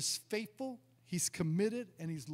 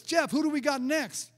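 raised, higher call across the stage; 6 semitones higher, 6 dB louder than usual. Retold or imitated. Evangelist calling to his helper on stage, raised call. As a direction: call out to the helper for the next one. Todd calls to Jeff on stage.

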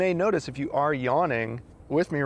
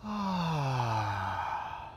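audible sigh; 5 dB quieter than usual. Shown as a demonstration. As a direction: sigh sliding from high to low. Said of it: "an audible sigh from high to low".

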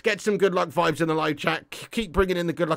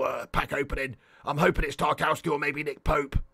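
low, lightly voiced football manager impression; lowered manager voice, falling away at phrase ends; Sean Dyche; 6 semitones lower, 4 dB quieter than usual. Manager hoping for luck in pack opening, Sean Dyche impression. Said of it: "Sean Dyche's kind of easy".

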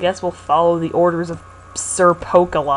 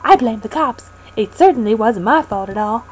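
Pokey voice; 9 semitones higher than usual. Acted. Voicing Pokey character.

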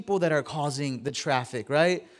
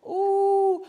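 high mock ooh; 14 semitones higher than usual. Reacting to the nurse laughing.

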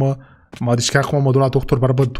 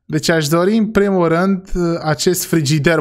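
ordinary talk

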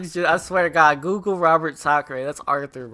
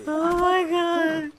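slow, high, breathless; worn out from laughing; 12 semitones higher, slower than usual. Real, not acted. Worn out after laughing at the impression, slow high sigh.

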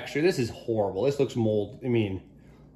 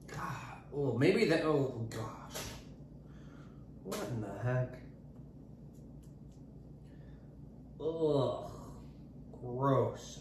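disgusted by taste; 11 dB quieter, slower than usual. Real, not acted. Bad melon taste.

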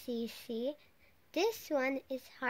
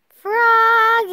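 loud, higher drawn-out call; 8 semitones higher, 16 dB louder than usual. A narrator's reading. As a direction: shout Froggy's name like a father calling him. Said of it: "called his father".

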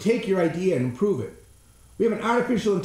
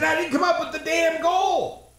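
high, indignant protest; exasperated retort; 10 semitones higher than usual. Retold or imitated. To the lady about her goal, indignant and higher. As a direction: push back, exasperated and higher. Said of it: "I said".

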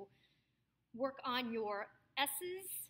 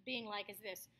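ordinary delivery

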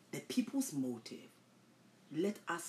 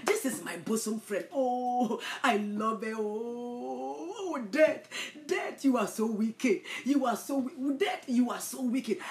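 mock lament; 5 semitones higher, 5 dB louder, slower than usual. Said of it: "you think that they are crying".

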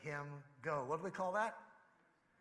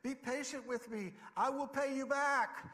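higher, rising plea, a touch louder at the end; acted pleading; 7 semitones higher, 3 dB louder than usual. Fellow servant on his knees pleads for more time, mildly acted. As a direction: beg for time, lightly voiced. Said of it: "fell to his knees and begged him".

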